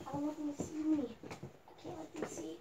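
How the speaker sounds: hushed, breathy whisper so nobody hears; hiding whisper. Pretend. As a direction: keep it secret, barely a breath. Hiding from the others.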